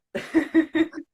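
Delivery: loud, high burst of laughter. Real, not acted. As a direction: laugh off the awkward overlap. Laugh after talking over guest.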